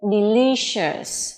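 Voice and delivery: "Delicious" drawn out with a clear sh; sh sound. Shown as a demonstration. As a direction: slowly model "Delicious", ending in shus. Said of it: "được phát âm là âm sh".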